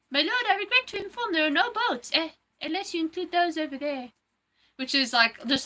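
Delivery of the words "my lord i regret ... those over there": high-pitched servant voice; movie-quote character. Acted. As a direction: deliver bad news to your lord, theatrically high. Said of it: "No, but he says".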